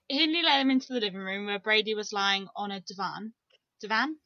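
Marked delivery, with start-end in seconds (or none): 2.8-3.3 divan with a long A, di-VAHN; long open second vowel in divan; posh pronunciation. Real, not acted. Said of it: "but I said divan". 3.8-4.2 divan with a short A, di-VAN, rising; short second vowel in divan, asked as a question; questioned pronunciation. Real, not acted. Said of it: "I know it's divan".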